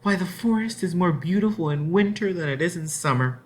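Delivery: happy cry. Acted. Cousin Annabelle delights in the winter forest, happy cry. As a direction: marvel aloud at the winter woods. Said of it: "Annabelle had cried out happily".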